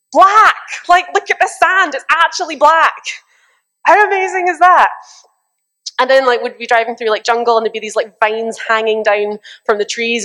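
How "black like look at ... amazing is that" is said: excited shouting. Retold or imitated. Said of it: "be like".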